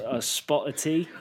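put-on playful stab at a British accent; hammed-up British; mock British accent. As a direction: toss out a stock British phrase, accent put on. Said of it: "now you're getting better".

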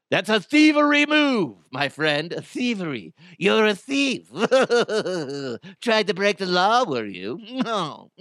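mock mustache-twirling villain, high and hammed-up; Conan O'Brien weird voice. Podcast host scolding the claw-machine kid in a hammed-up villain voice. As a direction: play a cartoon villain gleefully scolding a thief. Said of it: "I don't know why I talk like that".

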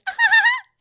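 imitated high, loud staccato laugh; Ramona. Host copying Ramona after her podcast remark, shrieky mock laugh. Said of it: "And then she does her laugh".

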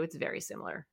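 whispered repeat under the breath; whispered aside. Real, not acted. Repeats the point softly.

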